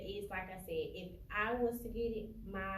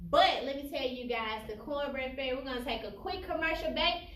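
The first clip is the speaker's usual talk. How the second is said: louder, upbeat host voice, stretched out; show host announcement; 5 semitones higher, 6 dB louder than usual. Acted. To the viewers, host cutting to commercial, upbeat announcer. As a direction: announce the break to the audience.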